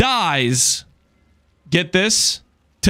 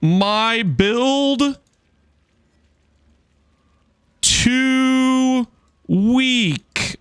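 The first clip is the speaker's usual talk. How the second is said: lightly mocking, higher pleading excuse; mock whiny excuse; 4 semitones higher, much slower than usual. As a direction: imitate a try-hard making a lame excuse. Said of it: "and this is an exact quote".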